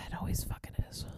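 whispered grumble; very soft, exasperated whisper; annoyed aside. Real, not acted. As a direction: grumble it under your breath, barely voiced. Aside grumbling about Siri interrupting.

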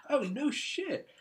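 high, drawn-out mock surprise; retold amazement. Friend learns of shared town, high slow retold surprise. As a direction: stretch it high, imitating his astonishment.

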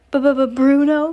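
high, loud shocked stutter; flustered father tripping over the name; stammered. A narrator's reading. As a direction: stammer on the first sound in alarm. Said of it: "Mister Jenkins stammered".

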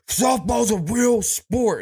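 high, slow, offended insistence; indignant softball girls. Retold or imitated. Coworkers protesting to Wyatt, slow high indignation.

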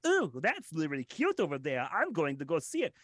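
a touch of a sweet excited voice; lightly put-on cute voice; cutesy random voice. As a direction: coo it like a charmed kid. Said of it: "pulling off random voices".

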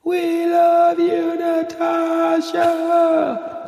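slow, high, stretched-out yell; long yell. Retold or imitated. Scouts yelling to the girl at morning assembly, drawn-out holler. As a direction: holler it across the valley, dragging every word. Second yell after countdown.

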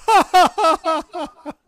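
high, loud mock laugh; forced fake laughter. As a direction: ham up a room full of kids fake-laughing. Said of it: "take themselves off mute on Zoom, like".

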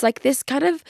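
ordinary talk, nothing marked

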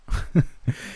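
airy unhinged giggle; high breathy laugh. Acted. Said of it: "I began laughing loudly".

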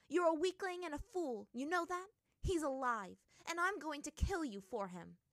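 high, heated insult turning to threat; angry threat. A narrator's reading. Princess insults and threatens her enemy.

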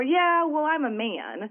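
high-pitched imitation of a dismissive uncle; mock his matter-of-fact brush-off. Uncle answering his niece about her business, mock dismissive.

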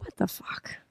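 hushed whisper of disbelief. Retold or imitated. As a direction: whisper it, spooked. Creeped out at movie scene.

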